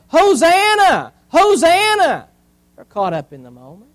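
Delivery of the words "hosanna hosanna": loud, high, drawn-out acclaim; crowd's shout. Acted. Preacher voicing the crowd shouting as Jesus comes, loud. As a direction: cry it out like a cheering crowd. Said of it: "they're probably there shouting".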